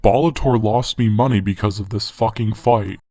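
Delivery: ordinary talk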